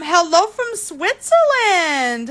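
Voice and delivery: ordinary talk, nothing marked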